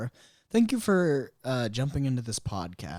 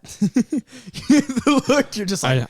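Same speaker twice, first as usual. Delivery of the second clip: high, breaking into laughter; can barely get it out for laughing; 12 semitones higher, 10 dB louder, much slower than usual. Real, not acted. Cracks up at co-host's look.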